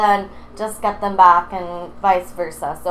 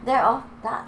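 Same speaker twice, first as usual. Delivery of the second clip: ordinary talk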